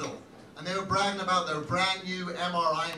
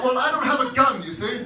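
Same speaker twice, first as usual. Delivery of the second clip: put-on raised, loud Chicago accent at first; bad Chicago accent; 5 semitones higher, 6 dB louder than usual. Chicago man answering about rocks, bad Chicago accent. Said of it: "That's my very bad Chicago accent".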